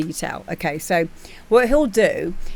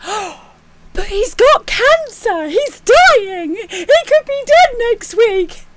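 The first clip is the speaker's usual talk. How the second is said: much higher, mock-tearful pleading; mock outraged audience; 5 dB louder, slower than usual. Audience protesting to the judge, much higher mock crying. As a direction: ham up a gasping crowd member near tears.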